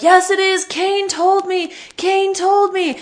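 high-pitched, drawn-out mock wail; Paul Bearer impression. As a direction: insist like a hysterical wrestling manager. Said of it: "And Paul Bearer's like".

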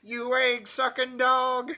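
high, drawn-out wrestler promo voice; Terry Funk. Acted. Co-host imitates a wrestler cutting a promo, high and drawn-out. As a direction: stretch it out like an old promo. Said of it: "in a Terry Funk voice".

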